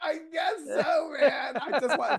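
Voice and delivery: high, loud burst of laughter; laughing shout. Real, not acted. Bursts out laughing at friend's joke.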